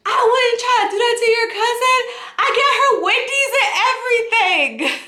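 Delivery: high, hammed-up whiny defence; indignant protest. Accused man to cousin on the phone, indignant protest.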